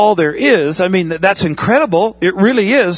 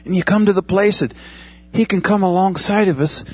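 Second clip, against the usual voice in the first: ordinary talk, nothing marked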